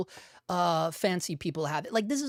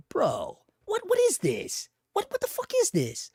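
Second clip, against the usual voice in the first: exasperated disbelief; 8 semitones higher than usual. Retold or imitated. To the camera maker over the price, high outraged disbelief. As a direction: pitch jumps way up in stunned outrage. Ranting about overpriced camera.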